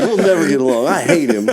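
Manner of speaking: high mock sobbing through laughter; Jimmy crying. Mimicking a friend's tearful complaint to his mom, laughing mock cry. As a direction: mimic a tearful kid, cracking up. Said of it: "Jimmy just starts crying".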